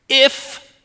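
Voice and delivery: "if" pitched high and left hanging; doubting "iffing". Acted. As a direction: hang a pointed high doubt on "if". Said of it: "don't you always hate it when somebody's".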